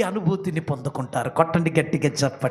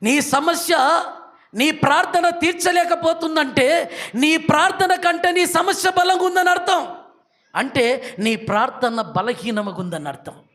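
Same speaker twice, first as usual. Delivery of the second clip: higher, louder rising preacher's litany; preaching at full force; 10 semitones higher, 6 dB louder than usual. Acted. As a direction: build the list like a revival preacher. Preaching climax.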